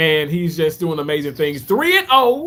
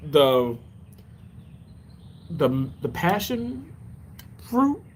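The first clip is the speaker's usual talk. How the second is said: normal talking voice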